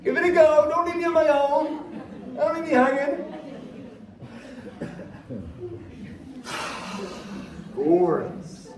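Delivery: excited. Real, not acted. Coaxing crowd to yawn, laughing.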